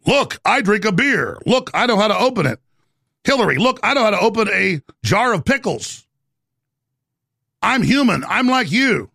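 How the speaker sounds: a hammed-up politician insisting she's human; mocking fake-relatable politician. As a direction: overdo trying to sound like an ordinary person.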